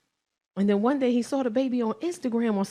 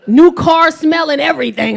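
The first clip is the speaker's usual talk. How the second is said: emphatic brag; 4 semitones higher, 11 dB louder than usual. Real, not acted. Bragging about new boyfriend.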